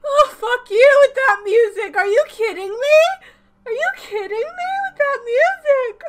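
loud, high sobbing protest; wailing in tears. Real, not acted. Viewer curses the show for its music, loud crying wail. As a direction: cry out furiously through the tears. Wails at sad music cue.

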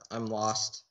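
usual speaking voice

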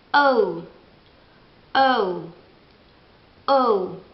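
O said as English oh-u glide. Shown as a demonstration. Teacher drilling English letter O. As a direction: round O into ou. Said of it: "Non esiste la lettera O".

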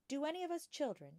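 put-on a bright young girl asking her teacher; young Matilda. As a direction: ask like a curious small girl. Matilda's childlike voice.

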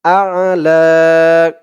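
আ'লা with the last a held long; one alif stretch. Shown as a demonstration. Teacher showing the alif stretch in আ'লা. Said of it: "এক আলিফ টান হবে".

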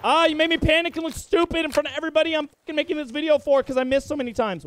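high, loud griping; exasperated outburst. Real, not acted. Player embarrassed on video by missed shots, exasperated outburst. Complains after missing shots.

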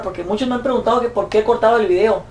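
ordinary talk, nothing marked

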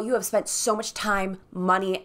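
normal talking voice

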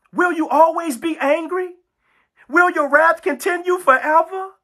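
high-pitched acted appeal to God; pleading sinner. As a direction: imitate a sinner begging for mercy. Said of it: "This is how you talk".

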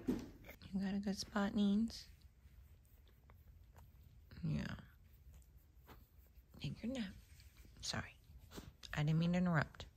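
hushed voice beside a napping dog. Real, not acted. Whispering near the napping dog.

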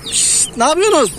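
high, slow coaxing call to the chicks; pet talk to chicks. Real, not acted. Farmer to his new chicks, high coaxing pet talk. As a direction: ask the chicks sweetly what they're up to.

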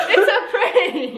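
high, slow, drawn-out words through giggles; laughing. Real, not acted. Wife tells confused partner it's a prank, laughing. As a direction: giggle while explaining the joke.

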